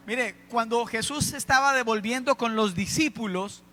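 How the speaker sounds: ordinary delivery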